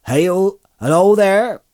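loud, high hail; calling out. A narrator's reading. Farmer to approaching rider in the mist, calling out. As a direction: shout a challenge into the fog. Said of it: "called Farmer Maggot".

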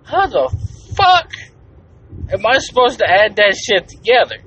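high, loud, baffled outburst; exasperated disbelief. Retold or imitated. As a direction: blurt it out as if math makes no sense. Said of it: "I'm like".

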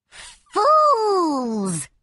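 high, loud cackling witch cry. A narrator's reading. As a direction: screech it like a witch. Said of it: "cried Babbitty's voice".

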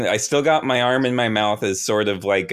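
usual speaking voice

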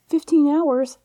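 surprised. Acted. Shocked at long duration.

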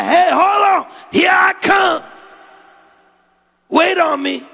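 hammed-up high holler; Moses calling out. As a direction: call after someone who is leaving. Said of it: "Moses said".

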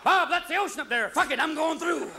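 crank it like you're on speed; wired trucker. Retold or imitated.

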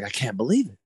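mock out-of-breath son; huffing and puffing. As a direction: gasp it like a spent runner, acted. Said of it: "he's huffing and puffing".

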